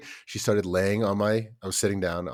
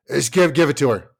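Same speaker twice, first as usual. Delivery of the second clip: exasperated giving in; 5 semitones higher, 6 dB louder than usual. Retold or imitated. Tired parent giving in.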